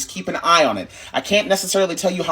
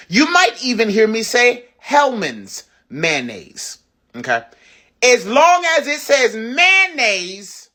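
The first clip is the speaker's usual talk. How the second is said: higher, slower, louder, stretched out; slow emphatic allowance; 7 semitones higher, 4 dB louder, slower than usual. Real, not acted. Telling viewers any mayonnaise will do, slow and emphatic. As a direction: stretch it out high and slow, making the point.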